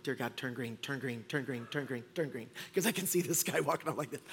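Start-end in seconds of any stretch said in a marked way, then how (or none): 0.0-2.5 very soft, lower pleading; desperate muttered prayer. Retold or imitated. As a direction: beg the light under your breath. Praying at red light.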